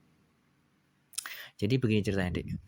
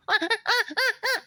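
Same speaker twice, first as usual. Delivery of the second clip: high laugh. Real, not acted. Narrator laughing at voice.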